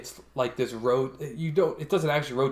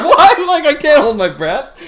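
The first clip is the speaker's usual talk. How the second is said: high, loud, laughing protest; laughing disbelief; 13 semitones higher, 13 dB louder than usual. Retold or imitated. Patient laughing at the CT tech's instructions, loud and high. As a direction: blurt it out through helpless laughter. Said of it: "with laughter".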